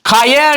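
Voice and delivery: கய said with a hard k in place of h; hard-k slip on கய; common mispronunciation. Retold or imitated. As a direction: start கய on k, as learners wrongly do. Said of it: "என்று உச்சரிக்கிறீங்க".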